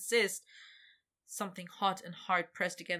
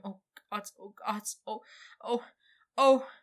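breathless moaning; 3 semitones higher, 6 dB louder than usual. Acted. Climax moaning.

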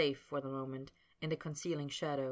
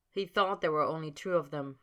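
usual speaking voice

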